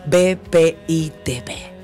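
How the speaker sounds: ordinary talk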